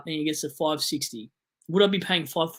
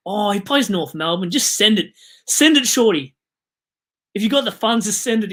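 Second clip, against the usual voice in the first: louder, higher pumped-up urging; hype-up; 4 semitones higher, 7 dB louder than usual. Acted. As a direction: egg the viewer on to go for it. Hyping viewer to trade player.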